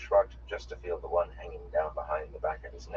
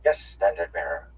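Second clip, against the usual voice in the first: snapped reply; 7 dB louder than usual. A narrator's reading. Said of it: "they snapped out as one".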